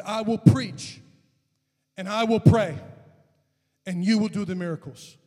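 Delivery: high, loud acted vow; retold defiant vow; bold declaration. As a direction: proclaim it to God in separate bursts.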